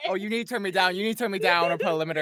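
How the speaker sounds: big, very loud voice over laughter. Real, not acted. Guest tells host to turn them down, loud. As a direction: warn them at full volume. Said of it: "'cause I'm a loud bitch".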